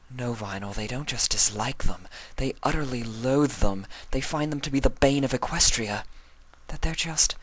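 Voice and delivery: building toward tears; upset Octavia. Acted. Octavia explaining how much her parents loathe them, rising distress.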